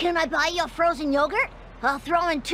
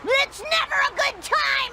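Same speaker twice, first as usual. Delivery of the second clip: anguished outburst; 13 semitones higher than usual. Acted. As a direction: cry it out at the top of your voice. Milhouse rebuffed by Lisa.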